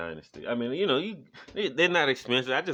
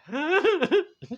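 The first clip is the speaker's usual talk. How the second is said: confused huh; 8 dB louder than usual. Retold or imitated. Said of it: "It was like".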